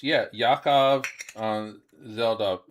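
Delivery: ordinary talk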